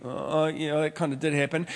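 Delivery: soft, reluctant admission; playing the caught member, quiet and sheepish; sheepish mumble. Sheepish reply of confronted member.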